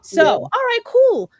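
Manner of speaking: bright rising so all right cool; pleased and lifted up high; excited. Real, not acted. Host hyped about pre-orders, excited.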